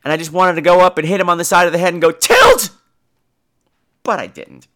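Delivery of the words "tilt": sudden high, loud yell; mock shout. Wanting to hit him on the head, mock shout. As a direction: burst out with one shouted word.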